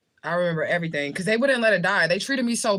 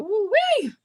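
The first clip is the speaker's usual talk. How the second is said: high, loud whoop of admiration; swooning whoop; 5 dB louder than usual. Real, not acted. Woman admiring Jamaican men's accent, excited whoop.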